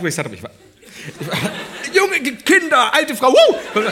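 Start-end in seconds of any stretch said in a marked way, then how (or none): 1.9-3.8 put-on much higher and louder, squealing like a drunk hen party; hammed-up partygoer whoops; mock shrill party squeal. Imitating the hen party girls, a high loud squeal.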